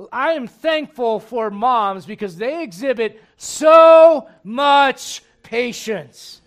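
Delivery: loud, slow and drawn out; emphatic and slow. Real, not acted. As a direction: lean hard on every word. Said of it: "emphatic slow praise of moms".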